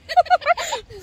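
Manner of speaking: very high burst of laughter; high, real cackle at someone's fright; startling laugh. Real, not acted. Someone got scared.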